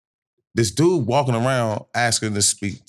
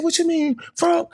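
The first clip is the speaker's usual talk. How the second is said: high-pitched imitation of a defensive relative; protesting cousin. Cousin answering the accusation, defensive. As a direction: play him protesting, pitched way up.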